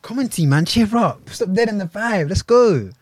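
loud, high pep talk; rally him like a coach; cheering up a friend. Real, not acted. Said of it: "I've been trying to cheer him up".

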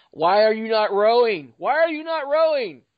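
loud, high frustrated shout; yelling at the TV. Retold or imitated. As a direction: yell at the screen in frustration. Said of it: "yelling at Jon to run".

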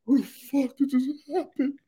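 mock sobbing lament; mock despair. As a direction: imitate a heartbroken voter. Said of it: "Hillary fans in New York just like".

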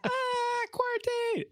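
very high falsetto squeal; squeaky falsetto. Pretend. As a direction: shriek it high like a cartoon. Echoing the silly voice.

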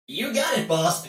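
high little robot voice; chirpy droid sidekick. Acted.

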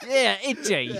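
laughing fake southern accent on "Itchy", drawn out and slipping; a botched Southern drawl that drifts into some other accent; mock southern accent. Shown as a demonstration. Insisting on the name to a friend in a laughing fake southern accent. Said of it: "That went into a weird other accent".

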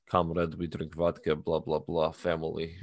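lightly imitated Russian accent; low mock-Russian voice. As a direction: put on a slight Russian accent. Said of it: "the Russian accent".

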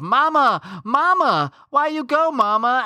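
put-on high child's voice crying out for her mother; little girl calling out. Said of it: "shouts of".

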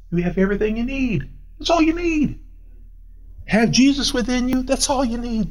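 ordinary delivery

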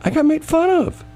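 high, whiny mock complaint; squeaky wounded protest; mock whine. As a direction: whine it like a hurt kid.

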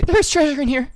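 high, excited moan of a line; breathy aroused voice. Acted. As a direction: gasp it out on the edge of pleasure. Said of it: "You, you say".

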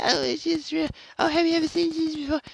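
very high, fast, silly mimicry; mocking imitation. Mimicking skeptics asking about seeing Jesus, mockingly. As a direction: gabble it in a squeaky mock voice.

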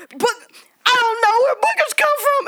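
high, cracking near-tears wail; mom breaking down. Retold or imitated. Worn-out mom answering her toddler, mock tearful wail. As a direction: imitate a mom at her breaking point, half crying. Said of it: "she just, like, broke down".

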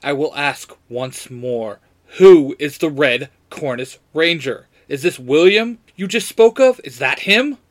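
mild demand, building from measured to loud. A narrator's reading. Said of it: "Jason demands".

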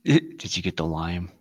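mock sinister. Mock sinister shopping list.

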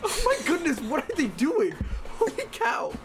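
high, loud incredulous laughter; laughing disbelief. Real, not acted. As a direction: laugh through it, amazed. Loud family noise upstairs.

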